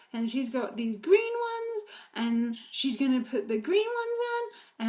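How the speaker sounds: put-on high, slow singsong toddler chatter; toddler rant. Parent imitating daughter's sock rant, high singsong. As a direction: mimic a little girl's rambling intonation. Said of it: "let me see if I can do it".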